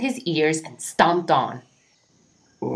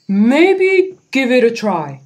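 gentle suggestion; 6 semitones higher, 6 dB louder than usual. Acted. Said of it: "suggested Norman".

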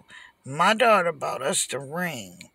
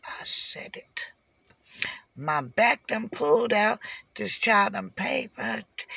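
ordinary delivery

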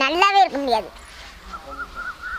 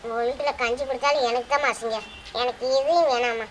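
bitter low refusal; 6 semitones lower, 7 dB quieter than usual. Real, not acted. Daughter refusing to return home, low and bitter. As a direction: lower and softer, pained.

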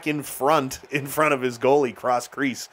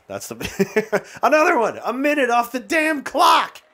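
high, loud, slow exasperated yell with a laugh; half laugh, half yell at the game; annoyed laughing shout; 11 semitones higher, 3 dB louder than usual. Real, not acted.

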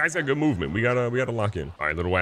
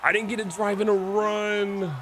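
whiny complaint; 10 semitones higher, slower than usual. Acted.

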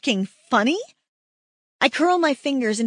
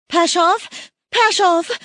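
terrified scream; 8 semitones higher, 6 dB louder, slower than usual. Acted. Frantic over fallen mate.

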